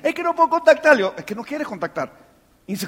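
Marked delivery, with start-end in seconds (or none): 0.0-0.9 higher-pitched imitation of an excuse-maker; mock excuse. Acting out people's excuse to him. 1.2-2.1 low, soft, matter-of-fact reply; quiet rebuttal. Acted. His deadpan answer to the excuse.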